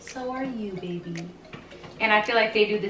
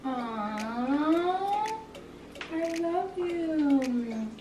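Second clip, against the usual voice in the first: tender aww; 8 semitones higher, 3 dB quieter, much slower than usual. Real, not acted. Tender reply to sister.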